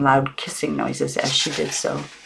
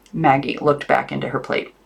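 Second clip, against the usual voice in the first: plain reading voice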